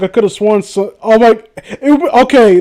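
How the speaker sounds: ordinary talk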